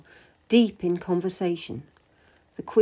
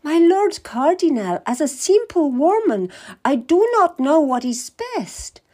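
higher, falsely humble; the simple woman act; modest queen; 8 semitones higher, 5 dB louder than usual. Acted. Queen feigning humility to the Cardinal, higher and humble.

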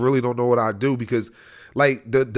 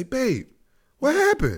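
shocked concern; 10 semitones higher, slower than usual. Retold or imitated. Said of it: "and you say".

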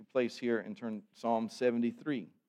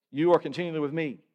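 "You are continually with me" said louder, higher and faster, pressing hard; emphatic louder reversal; 4 semitones higher, 8 dB louder, faster than usual. Shown as a demonstration. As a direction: punch out the corrected line with fervour. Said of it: "I would rather say".

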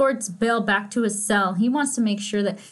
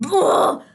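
frustrated groan; 8 semitones higher, 5 dB louder than usual. Real, not acted. Furious about Bale.

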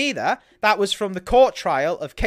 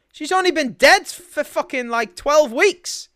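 raised indignant rant, slow on the last words; outraged; 8 semitones higher, 6 dB louder, slower than usual. Real, not acted. Podcaster ranting to cohosts, outraged and loud. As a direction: spit it out in disbelief. Furious rant about Meghan.

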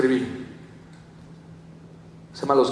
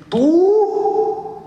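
much higher, louder, one-word gasp of disbelief; shocked disbelief; 5 dB louder than usual. Retold or imitated. John Maxwell learns the young speaker runs the event, in loud high disbelief. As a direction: squeak it out in disbelief.

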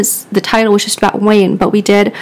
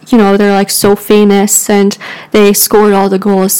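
ordinary talk, nothing marked

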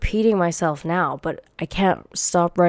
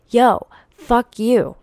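angry outburst; 5 semitones higher, 5 dB louder, slower than usual. Real, not acted. Venting at imagined critic.

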